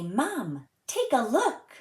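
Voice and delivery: put-on proud child's call, rising high; excited kid. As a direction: show off the first fish. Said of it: "I say".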